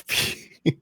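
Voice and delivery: normal talking voice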